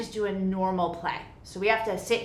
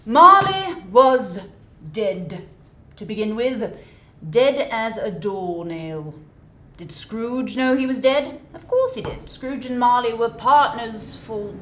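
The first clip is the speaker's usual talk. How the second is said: louder, slow dramatic storytelling; theatrical narrator; story narration; 2 semitones higher, 9 dB louder, slower than usual. A narrator's reading. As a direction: read it like a fireside tale.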